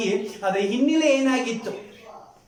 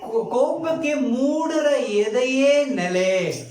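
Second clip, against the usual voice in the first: slow, higher-pitched verse proclamation; scripture declamation; 5 semitones higher, much slower than usual. Real, not acted. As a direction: proclaim the verse slowly to the congregation. Preacher repeats verse aloud to congregation. (ವಚನವನ್ನು ನಿಧಾನವಾಗಿ, ಏರಿದ ಧ್ವನಿಯಲ್ಲಿ ಘೋಷಿಸುವುದು)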